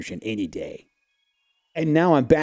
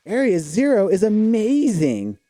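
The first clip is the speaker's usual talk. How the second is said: awed excitement; 10 semitones higher, 4 dB louder than usual. Acted. Marvelling at the view.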